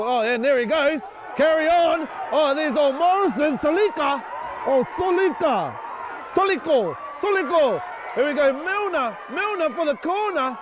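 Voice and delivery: live excited play-by-play; excited commentary. Real, not acted. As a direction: call the break with rising thrill.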